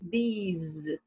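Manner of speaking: these, ending in a buzzing Z; these with Z sound. Shown as a demonstration. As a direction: finish these on a Z. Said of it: "com som de Z no final". (these com som de Z no final)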